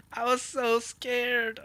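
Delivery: mock crying. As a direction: whimper it out like a rescued woman. Voices crying woman from manga.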